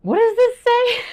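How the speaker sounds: high, loud squeal of confusion; baffled exasperation. Retold or imitated. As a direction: throw up your hands at an impossible text. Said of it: "went to my husband at one point and was like".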